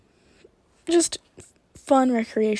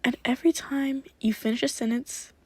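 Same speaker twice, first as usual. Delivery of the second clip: quick cheerful hypnotist patter; upbeat hypnotic suggestion; 4 dB quieter, faster than usual. Acted. New playful suggestion.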